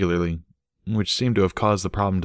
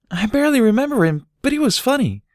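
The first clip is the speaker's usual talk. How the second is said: put-on much higher, louder child voice; a small filly remembering fondly; little Dinky; 4 dB louder than usual.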